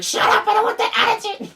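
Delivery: very high, fast scolding screech; witch-like cartoon mother. Shown as a demonstration. Cartoon mother answering back to a child, witch-like screech. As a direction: cut the kid off like a witchy mom. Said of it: "witch-like voice".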